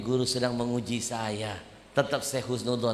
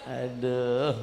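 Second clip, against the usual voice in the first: ordinary talk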